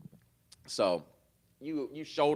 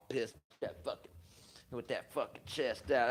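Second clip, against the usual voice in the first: usual speaking voice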